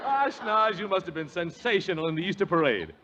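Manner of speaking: ordinary talk, nothing marked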